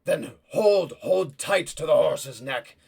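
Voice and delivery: loud, urgent order; urgent command. Acted. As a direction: tell her to hold on hard. Lord orders during escape.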